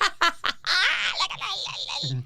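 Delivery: high, wild mock laughing fit; demonstrated tearful laugh. Shown as a demonstration. Re-enacting her reaction to bad news, helpless laughing. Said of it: "all I could do was laugh just like that".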